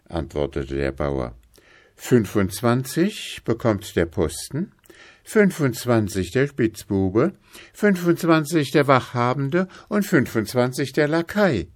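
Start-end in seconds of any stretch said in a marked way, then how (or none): 1.9-11.7 higher-pitched farmer reckoning up a list; lightly voiced sly farmer, pleased. Farmer dividing the blows among the cheats, lightly voiced. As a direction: count out each share with relish.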